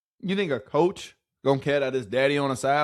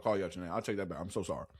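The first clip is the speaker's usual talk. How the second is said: much softer, lower, sheepish take-back; hushed hammed-up sorry; mock apology; 9 semitones lower, 15 dB quieter, much faster than usual. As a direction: pretend to walk it back under your breath. Mock apology after insult.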